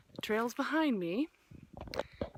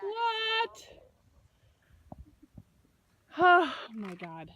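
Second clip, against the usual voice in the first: amazed; 10 semitones higher, 8 dB louder, much slower than usual. Real, not acted. Amazed at the view.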